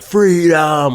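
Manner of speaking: high, far-off bellowed war cry, imitating Braveheart; mock Braveheart yell; battle cry. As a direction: belt it out like a rallying charge. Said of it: "turned around at the crowd and was like".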